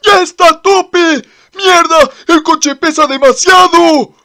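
high, loud desperate yelling; frantic shouting. Acted. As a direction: shout back in a panic. Tief answers during chase.

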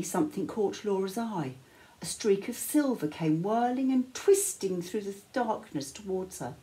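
usual speaking voice

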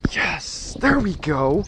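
real burst as the fish is back; excited cheer. Real, not acted. Angler landing the bass, excited cheer.

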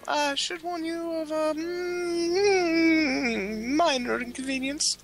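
high, fussy aristocrat voice; high posh gentleman voice. Acted. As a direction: play a prim nobleman, voice up high. High posh character voice held.

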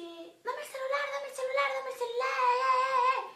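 high, slurred, sing-song demand; slurred junkie. Retold or imitated. The junkie slurring demands for the phone, high and slurred. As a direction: mumble it like a drugged robber. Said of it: "No se le entendía un sorungo".

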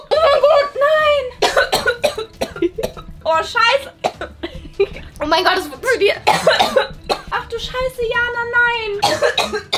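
high, loud shrieks between coughs; shrieking jackpot winner. Real, not acted. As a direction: shriek in real panic and disbelief. Pepper spray goes off, coughing.

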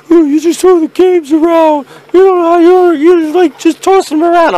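high, loud nagging whine; mocking whiny viewers. Imitating angry viewers scolding him for throwing games. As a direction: nag like a fussy commenter. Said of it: "They go like".

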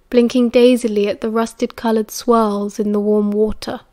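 ordinary delivery

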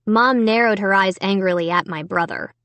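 usual speaking voice

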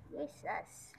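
playful whispered tease. Pretend. Vlogger whispers a tease to his puppy. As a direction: whisper it to the dog.

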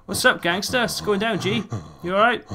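high, loud mock cheery greeting; silly greeting voice. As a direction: ham up a friendly hello. Greets the creature mockingly.

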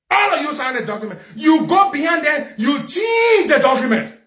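outraged accusation. Real, not acted. Accusing the man of changing the signed document, outraged. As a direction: hurl the accusation, building to a shout.

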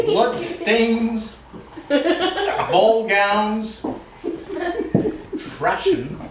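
reading while the giggles take over; laughing through each word, pitch jumping up; mock dramatic reading breaking into laughter. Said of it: "we have a dramatic reading".

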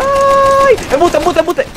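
high, loud cry of protest; startled yell. Real, not acted. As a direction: yell it in outrage. Getting killed in game. (grito alto de protesta)

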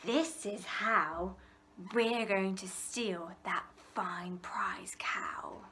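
slow, secretive whispering of thieves. A narrator's reading. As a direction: hush it like a plotting crook. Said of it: "And she heard them whisper".

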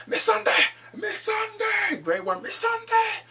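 high-pitched mock desperate calls; imitated yelling. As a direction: cry out the name, stretched and high. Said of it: "we hear what's name yelling".

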